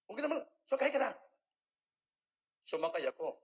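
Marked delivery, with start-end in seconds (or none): 0.1-1.1 quick, pressing, higher urging; insistent rapid coaxing; fast urging. Retold or imitated. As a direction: hurry him aboard, rattled off. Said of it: "Sabi ni Malpa".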